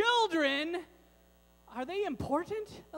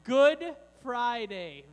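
Good Friday said slowly and louder, stress on good; slow affirming repeat; 9 dB louder, much slower than usual. Shown as a demonstration. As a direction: confirm the answer slowly, leaning on good. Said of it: "Very good".

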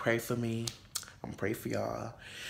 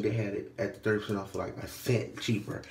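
usual speaking voice

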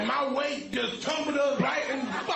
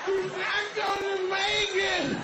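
higher, louder frantic plea; higher, louder beachgoer calling for help; 6 semitones higher, 3 dB louder than usual. Retold or imitated.

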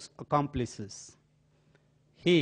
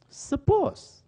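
Suppose thrown up high and louder; emphatic suppose; 6 dB louder than usual. Retold or imitated. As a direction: fling the word Suppose up high as a challenge. Aunt challenges boy to marry.

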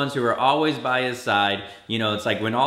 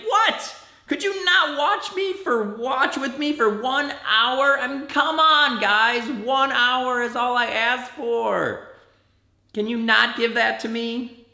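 exasperated yell; 10 semitones higher than usual. Retold or imitated. Jesus scolding the sleeping disciples, high exasperated mock yell. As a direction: scold the sleepy friends, pitched way up. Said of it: "yells at them".

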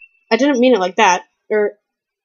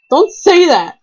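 louder, higher cry of protest; protesting yell; 6 semitones higher, 4 dB louder, slower than usual. Real, not acted. Protesting when the co-player says he'll die, a sharp yell. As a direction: shout it down indignantly.

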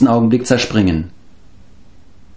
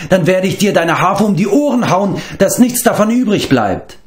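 put-on higher, defiant scolding girl; fiery girl; 8 semitones higher than usual. A narrator's reading. Eilonvi threatens the bard with his harp, defiant and higher. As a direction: hands on hips, daring them to stop her. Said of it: "ihre Augen blitzten".

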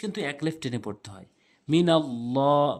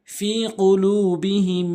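slow chanted tajweed reading; Quran recitation; 4 semitones higher, 7 dB louder, much slower than usual. Shown as a demonstration. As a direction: recite slowly, stretching the madd. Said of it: "Quran recitation". (ধীরে টেনে টেনে তিলাওয়াত)

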